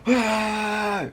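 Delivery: high mock charge yell with a grin; hammed-up charge; playful fake battle cry. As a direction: cry out like a friendly fake attacker. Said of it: "he's like".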